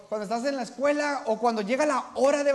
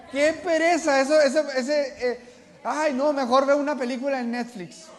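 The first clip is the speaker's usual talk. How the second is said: mock lazy excuse; 3 semitones higher, 5 dB louder than usual. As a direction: whine it like someone dodging the task. Voicing a lazy believer's excuse.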